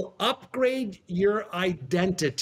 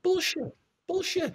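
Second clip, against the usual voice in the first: high, scoffing inner voice; 11 semitones higher than usual. Retold or imitated. Young man scoffing silently at the mentor's question, high. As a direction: scoff it in your head, pitched up. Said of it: "bullshit's what my head was saying".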